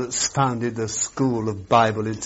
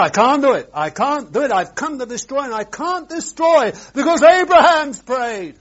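high, loud insistent refusal; God's voice; 10 semitones higher, 6 dB louder than usual. Acted. Preacher voicing God bound by Abraham, loud and high. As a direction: let God protest he is bound.

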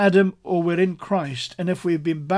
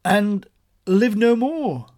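raised, loud, "live no more" stretched out; emphatic declaration; 5 semitones higher, 5 dB louder, slower than usual. Shown as a demonstration. Preacher voicing Paul's testimony, emphatic declaration. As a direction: proclaim "live no more" high and slow. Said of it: "he's really emphasizing this".

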